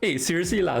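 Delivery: ordinary talk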